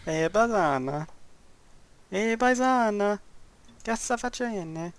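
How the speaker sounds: slow mock Italian accent, drawn out. Gamer joking about Italians, slow mock Italian accent. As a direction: ham up an Italian street voice. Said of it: "Or something like that".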